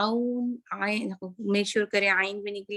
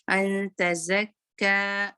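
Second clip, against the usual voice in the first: slow chanted recitation; measured Quran recitation; much slower than usual. Shown as a demonstration. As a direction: hold it two counts, no more. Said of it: "दो count".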